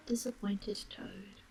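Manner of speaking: ordinary delivery, nothing marked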